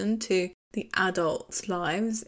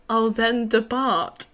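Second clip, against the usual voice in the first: slow, louder, grand dismissal; mock medieval knight; 3 semitones higher, 6 dB louder, slower than usual. Drunk visitor told nobody's home, mock-grand dismissal. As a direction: draw it out with mock pomp. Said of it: "He turns into a medieval knight".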